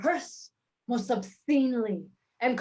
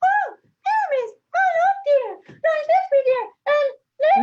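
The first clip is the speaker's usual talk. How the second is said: squeaky falsetto, much higher and louder; tiny monster trying fierce; 6 dB louder than usual. Shown as a demonstration. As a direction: shriek it like a tiny lady. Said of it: "monstrous little voice".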